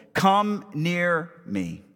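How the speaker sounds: each word stretched and spaced; deliberate, drawn-out command; slow summons. Acted. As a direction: beckon the crowd very slowly, word by word. Elijah summons the people.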